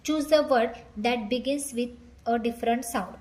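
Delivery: each English word spaced out very slowly; slow dictation reading. Shown as a demonstration. Teacher reading the question to students, very slowly.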